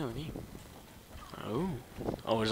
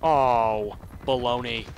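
loud; 10 dB louder, slower than usual. Real, not acted.

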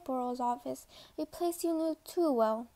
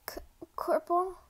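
hesitant, higher stammered question; shaky stutter; 3 semitones higher than usual. Acted. Cadet waking to see the corporal, shaky stutter. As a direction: trip on the first sound, unsure. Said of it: "you stuttered in a shaky voice".

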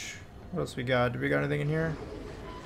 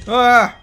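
startled yelp; 7 semitones higher, 12 dB louder than usual. Real, not acted. Getting shot.

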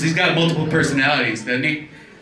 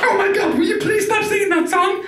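very high pleading shout; squeaky puppet. Retold or imitated. A puppet pleading over Elmo's singing, high and shrill. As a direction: shriek at him to stop.

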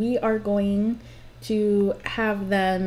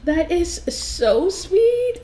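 gushing; 7 semitones higher, much slower than usual. Real, not acted. Gushing over the couple.

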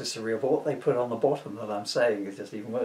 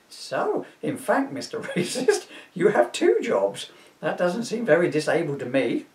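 bureaucrat voice; 5 semitones higher, 5 dB louder than usual. Acted.

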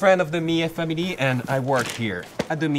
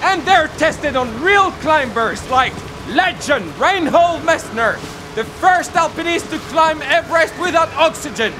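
excited; 11 semitones higher than usual. Acted. Hyped product pitch building up.

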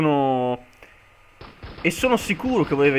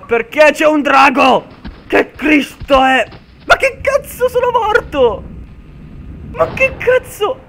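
shocked yelling; 12 semitones higher, 9 dB louder, slower than usual. Real, not acted. Killed suddenly by a dragon.

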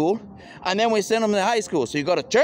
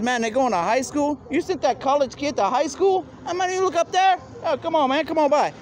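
high incredulous voice; 10 semitones higher than usual. Acted. Someone hears the college kid went to high school, incredulous high voice. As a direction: voice climbing high in disbelief. Excited voiced quote, rising shock.

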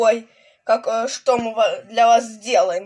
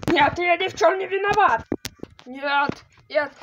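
panicked pleading; 7 semitones higher than usual. Acted. Pleading before the void.

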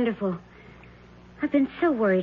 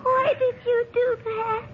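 stunned fear. Acted. Victim stabbed, shocked.

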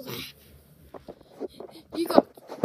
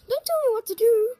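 fast, higher, louder retort; snapping back; 7 semitones higher, 7 dB louder, faster than usual. Acted. Actor snapping at the prompter. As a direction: snap back defiantly.

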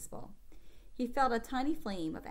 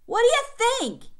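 loud, much higher furious shout; 13 dB louder than usual. Acted. Hurt boy shouting at his sister's offer of ice, furious. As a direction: bark it back at her, full force. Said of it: "he shouted".

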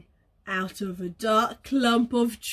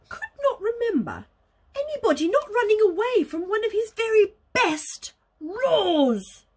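ordinary delivery, nothing marked

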